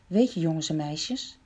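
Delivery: ordinary talk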